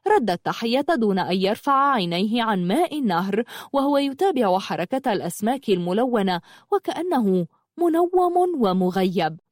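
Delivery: ordinary delivery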